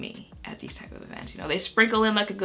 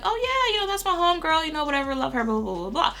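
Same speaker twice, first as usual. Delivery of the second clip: starts very high, sliding down into blah-blahs; mock homegirl chatter, tapering off; imitated breezy reply; 10 semitones higher, faster than usual. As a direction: toss it off as a high, gushy imitation. Acting out influencer's breezy reply.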